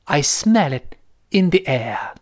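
slow, measured British film-prologue recitation; thick British accent. Retold or imitated. Teacher quoting the Lord of the Rings prologue, thick British accent. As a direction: recite in British English, no curled r, paused between phrases. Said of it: "浓浓的英式发音".

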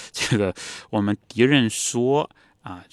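ordinary talk

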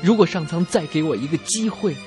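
ordinary delivery

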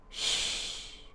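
soft, unvoiced shushing sound; a long, very soft "shh" for quiet; hushing shh. A narrator's reading. Mother hushes her son after the blocks crash, hushing.

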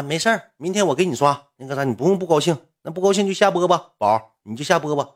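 high-pitched reassurance in a put-on voice; mock consoling viewer. Female viewer consoling the upset streamer, high mock reassurance. 女观众安慰主播下播.

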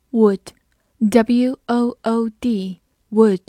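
very slow, letter by letter; teacher spelling a word for students. Shown as a demonstration. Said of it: "spelling the word out".

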